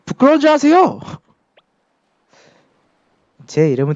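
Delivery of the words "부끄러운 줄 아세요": high, loud indignant reproach; indignant scolding. Acted. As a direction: scold with outrage. Child scolds adult.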